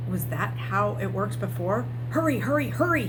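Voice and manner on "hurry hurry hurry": higher, urgent hurry; urging himself. Acted. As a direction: push yourself to hurry. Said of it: "he urged himself".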